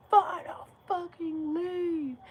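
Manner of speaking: high, loud, slow mock of the man. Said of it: "he goes like".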